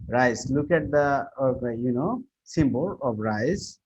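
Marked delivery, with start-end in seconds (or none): none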